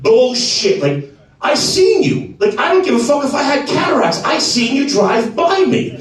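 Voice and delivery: retold worked-up husband confronting his wife; indignant accusation.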